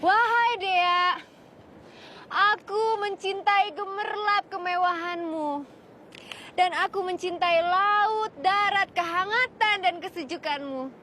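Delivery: hammy stage actor. Shown as a demonstration. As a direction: proclaim it grandly on stage. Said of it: "Dengar nggak?". (deklamasi teatrikal yang megah)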